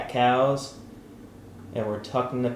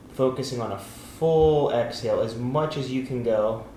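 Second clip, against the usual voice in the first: guided breathing. Acted. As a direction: talk them through each breath gently.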